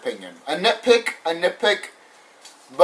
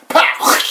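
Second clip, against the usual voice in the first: mock jump-scare pop; 6 dB louder than usual. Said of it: "you coulda just went like".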